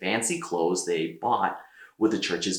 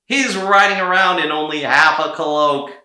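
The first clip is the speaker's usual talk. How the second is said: louder scornful taunt; mocking jeer; 7 semitones higher, 9 dB louder than usual. A narrator's reading. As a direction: sneer at the half-cloaked fool. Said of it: "laughing at him".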